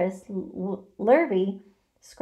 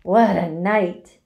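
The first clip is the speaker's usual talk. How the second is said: gloating; 7 dB louder, slower than usual. A narrator's reading. Rat boasting of his feast.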